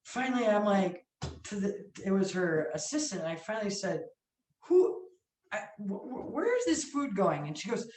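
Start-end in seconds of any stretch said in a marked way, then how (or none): 4.6-7.5 high, halting, stumbling question; nervous stammer. Retold or imitated. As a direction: stammer your way into asking, re-enacted. Retold nervous question to assistant.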